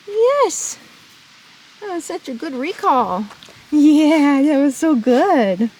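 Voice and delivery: loud, slow gushing praise; squeaky praising a dog. Real, not acted. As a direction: praise her like a proud owner. Praising horse after recall.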